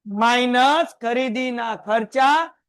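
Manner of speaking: each word drawn out slowly for the class to repeat; teacher leading a slow choral recital. Shown as a demonstration. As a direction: chant it slowly with the students. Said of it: "બધાય મારી સાથે બોલો".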